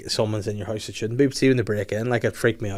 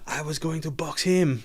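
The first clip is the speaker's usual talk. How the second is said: mock boast; 6 semitones higher than usual. Retelling the friend's boast.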